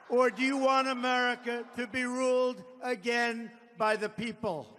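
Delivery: rising rally cadence; rally speech. Real, not acted. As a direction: address the crowd with rising force. Campaign rally address.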